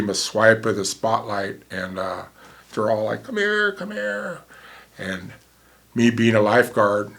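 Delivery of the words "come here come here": high, far-off call of come here. Retold or imitated. Strangers waving the paramedic over, calling out. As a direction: call them over from a distance, pitched high.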